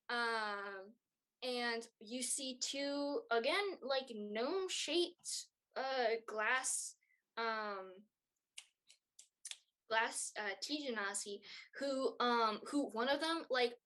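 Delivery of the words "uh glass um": ordinary talk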